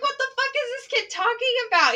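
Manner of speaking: high, fast, loud disbelief; exasperated inner voice, replayed; retold incredulity. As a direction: blurt the baffled thought quickly. Retells own baffled thought.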